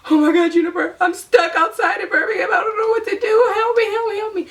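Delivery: frantic plea for rescue, teary; panicked crying plea. Retold or imitated. Panicked call to friend at 3am.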